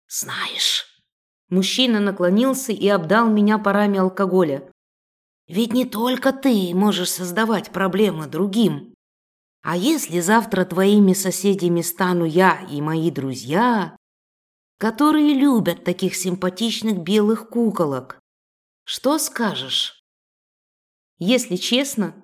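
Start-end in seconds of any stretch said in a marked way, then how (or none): 0.1-0.9 breathy unvoiced whisper; singsong whisper. Thug leans in, whispering. 5.5-20.0 menacing thug voice. Acted. Thug threatens heroine.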